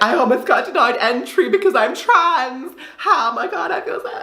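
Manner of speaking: high, theatrical put-on distress; mock dramatic scene. Making a scene at the desk, high and theatrical. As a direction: ham it up to shame the officer. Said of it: "I was trying to make a scene".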